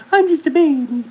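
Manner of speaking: put-on high, loud baby-dog voice; voicing the dog. Speaking for the dog to her mother: high loud baby voice. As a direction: whine like a spoiled little puppy.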